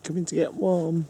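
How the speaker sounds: high-pitched call over to a partner; calling out invitingly. Real, not acted. Partner inviting the other to warm by the fire, high call. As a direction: lift the voice right up to beckon.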